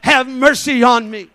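loud pleading cry for mercy. Retold or imitated. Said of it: "begins to cry out the louder".

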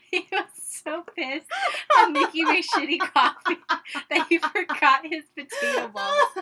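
loud, high telling through laughter; real laughing. Real, not acted. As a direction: barely get the story out for laughing. Cracking up while telling dad story.

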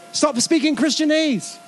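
normal talking voice

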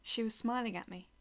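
plain reading voice

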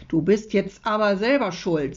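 plain reading voice

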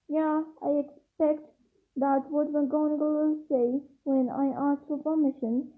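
usual speaking voice

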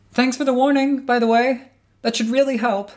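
high, far-off call across the room; called back. Acted. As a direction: call it back loudly, a touch wry. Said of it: "Avery called back".

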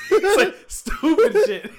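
high, loud laughing through the words. Real, not acted. Host cracking up over the prank he retells, laughing. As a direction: crack up at your own story. Cracking up at his own story.

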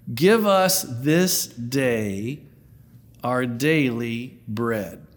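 slow, weighty quoting of the prayer; slow reverent quote. Shown as a demonstration. Preacher to congregation, slow solemn quote. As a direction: lay each word down slowly.

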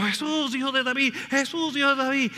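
high pleading call of the blind men; crying out. Retold or imitated. Blind men crying out to Jesus, pleading call. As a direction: call out for Jesus over the crowd. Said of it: "están gritando".

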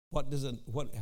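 ordinary talk

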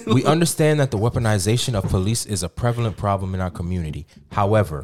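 formal, low statement reading; official statement read. Acted. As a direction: read it like a press release. Reads the school's statement aloud.

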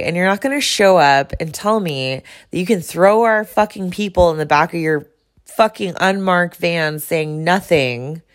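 usual speaking voice

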